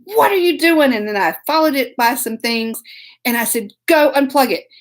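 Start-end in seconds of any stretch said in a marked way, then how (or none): 0.0-0.9 high, louder mock yell; raised-voice retold outburst. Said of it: "I yelled, y'all". 3.9-4.7 moderately raised, sharp order; retold angry command; retold yell. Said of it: "I yelled, y'all".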